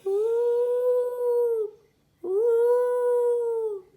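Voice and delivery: very high, loud spooky owl hoot; ghostly ooh in the night; eerie hoot. A narrator's reading. Eerie owl noise in story.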